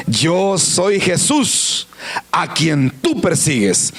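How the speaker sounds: very slow, weighty divine declaration; Jesus answering slowly and gravely; slow, clear answer. Acted. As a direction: spell it out solemnly, word by word. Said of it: "por si acaso no le había entendido".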